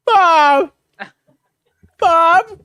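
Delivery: high calling for a lost dog; retold high call for a dog. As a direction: call the dog's name up high into the night.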